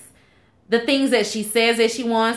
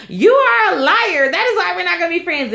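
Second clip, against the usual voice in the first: Shannon yelling; 7 semitones higher than usual. Retold or imitated. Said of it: "And Shannon's like".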